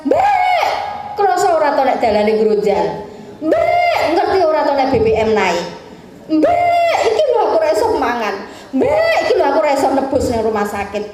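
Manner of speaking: high bleating Mbak then fast grumbling; mock complaint of the governed, each Mbak bleated; complaining citizen. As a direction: whine like people griping at their leader. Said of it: "rakyatnya ... ngegas".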